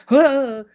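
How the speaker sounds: loud sharp gasping huh; a big theatrical inhale; mock gasp. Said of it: "as he's like".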